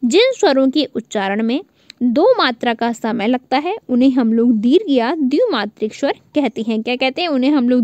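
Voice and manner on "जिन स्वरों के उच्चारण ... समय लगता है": normal talking voice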